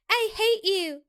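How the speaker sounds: high, mock-exasperated groan. A narrator's reading. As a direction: groan it playfully. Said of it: "she groans".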